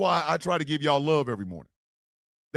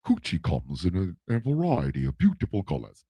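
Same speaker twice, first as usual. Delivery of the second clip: low, put-on Cockney voice; thick Cockney accent; 12 semitones lower, 4 dB quieter than usual. Host reading a professor's comment aloud, thick Cockney accent. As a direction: read it out as a deep-voiced Cockney. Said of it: "in that thick-ass Cockney accent".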